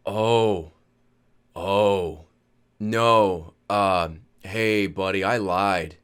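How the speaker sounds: low, slow, halting confession; lightly voiced woozy father owning up; sheepish drunk dad.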